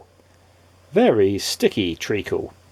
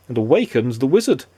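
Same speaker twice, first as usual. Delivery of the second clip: usual speaking voice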